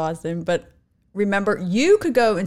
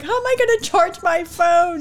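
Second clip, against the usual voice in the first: put-on higher, lightly whiny kid voice; a hint of a worried little boy; imitating crying boy; 8 semitones higher than usual. Mom imitating her tearful son in the car, higher and whiny. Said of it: "He's like".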